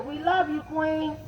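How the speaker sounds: ordinary talk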